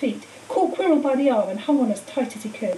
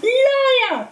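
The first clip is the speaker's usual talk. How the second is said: much higher, louder outburst; sudden shout; 13 semitones higher, 6 dB louder than usual. A narrator's reading. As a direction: hurl the word at him. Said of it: "Harry shouted suddenly".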